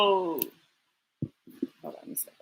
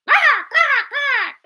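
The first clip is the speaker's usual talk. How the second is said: duck quacks; 11 semitones higher, slower than usual. Acted. Duck noise.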